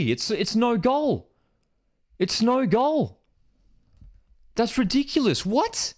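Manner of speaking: high, incredulous protest at the call; can't believe the goal is ruled out; disbelief. Real, not acted. Streamer to chat, protesting the disallowed goal in high disbelief.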